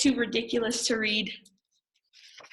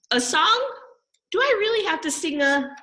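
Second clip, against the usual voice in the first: dismayed surprise; 9 semitones higher than usual. Acted. Reader balks at having to sing, high and alarmed. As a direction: yelp it in disbelief.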